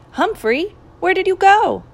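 high, loud startled call; alarmed surprise. A narrator's reading. Mister Morales finds the hamster's cage empty, alarmed. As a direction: call out in alarm for a missing pet. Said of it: "Mister Morales gasped when he saw".

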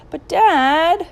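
high, loud, drawn-out whine; whining. A narrator's reading. Willie protests his father's order, whining. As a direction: whine in protest like a put-upon child. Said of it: "Willie whined".

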